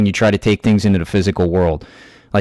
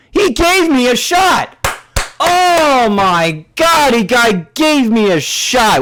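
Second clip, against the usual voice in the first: much higher, slow, drawn-out mock amazement; sarcastic mock awe; 13 semitones higher, slower than usual. Mocking the rival's offer of a shot, in a slow, high fake-awed voice. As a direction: gush over it like a starstruck fan, sarcastically.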